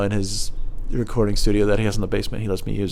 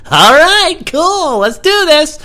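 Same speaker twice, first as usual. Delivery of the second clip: high, loud forced cheer; fake enthusiasm; 9 dB louder, much slower than usual. To the rappers in his shaking car, high fake cheer.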